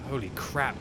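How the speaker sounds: ordinary delivery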